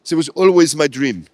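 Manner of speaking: put-on light French accent in English; self-mocking French accent. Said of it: "I've got a very bad French accent in English".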